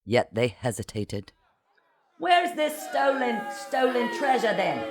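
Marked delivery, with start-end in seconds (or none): 2.2-4.8 high, distant-sounding shout; far-off high heckle; shouting from rear. A narrator's reading. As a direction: call it from the back of a crowd. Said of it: "the helmsman shouted from the rear".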